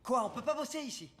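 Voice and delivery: high-pitched, loud, fed-up complaint; exasperated outburst. Acted. Fed up with people chatting while working, exasperated.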